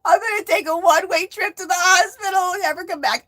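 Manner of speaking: high, loud panicked wail; freaking out. Retold or imitated. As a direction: cry out the fear of never coming back. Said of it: "I was freaking out".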